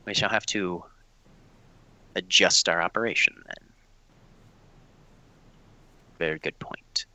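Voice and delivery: low, soft in-character answer, sinking to a whisper; measured murmur that drops away to a whisper; hushed reply. Acted. NPC answers a whispered confidence.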